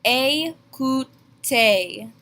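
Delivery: Écoutez stretched out, ay-koo-tay with matching ay sounds; very slow French demo. Shown as a demonstration. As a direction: draw it out syllable by syllable. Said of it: "They make the exact same A sound".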